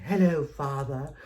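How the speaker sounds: high, loud, slow posh British "Hello, Father"; mock British accent. Children greeting their dad in a British accent. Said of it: "adopted a British accent".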